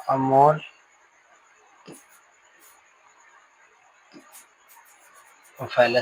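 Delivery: amorphophallus drawn out very slowly, syllable by syllable. Shown as a demonstration. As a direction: stretch amorphophallus into slow separate syllables. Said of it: "जैसे हम लोग पढ़ते हैं".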